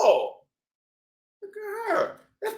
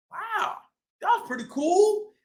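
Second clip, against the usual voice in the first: plain reading voice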